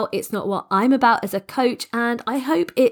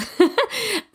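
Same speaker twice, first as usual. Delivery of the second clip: short laugh; 12 semitones higher than usual. Real, not acted. Laughs at own croaky voice.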